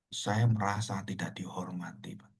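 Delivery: soft, near-whispered admission, acted out; hushed hurt confession. Elder confides hurt to the pastor, retold in a hush. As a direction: drop to a low hush for the wounded man.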